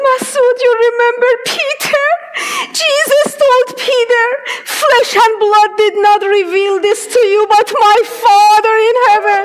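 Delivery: high, loud, crying with joy; tearful excitement. Retold or imitated. Telling her friend about Peter, a high tearful joy. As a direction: burst out the answer through happy tears.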